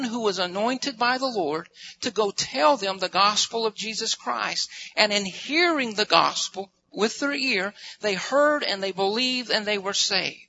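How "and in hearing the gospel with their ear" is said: ordinary delivery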